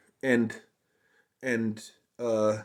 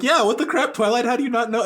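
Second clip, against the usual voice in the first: much higher, louder mock-indignant squeal; mock outrage; 13 semitones higher, 5 dB louder than usual. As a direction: squeal it in cartoonish disbelief. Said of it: "it's like".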